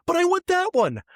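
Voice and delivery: put-on high, whining like a child; whiny adult. Grown-up whines for his lost wallet like a child. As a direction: plead like a stubborn toddler.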